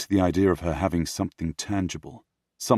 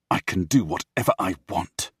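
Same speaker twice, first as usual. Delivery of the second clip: plain reading voice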